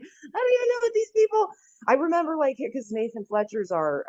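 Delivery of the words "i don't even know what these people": naive past self. Retold or imitated. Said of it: "I was like".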